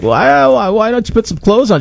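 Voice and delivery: mock exasperation. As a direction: mimic people demanding an answer. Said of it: "a lot of people are like".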